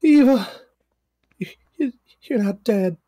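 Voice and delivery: high, relieved voice; relieved Cassie. Acted. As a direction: choke out the relief.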